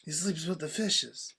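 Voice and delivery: slow, very soft gangster voice; mob boss impression. Trading Godfather lines with co-host, slow hushed impression. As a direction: deliver it hushed and unhurried like a don.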